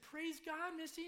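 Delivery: high, loud burst of praise; rejoicing. Retold or imitated. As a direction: re-enact overjoyed relief. Retold rejoicing at good news.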